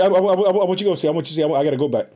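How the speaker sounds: ordinary delivery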